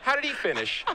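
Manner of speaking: imitating his mom. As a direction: mom politely pressing on, lightly voiced.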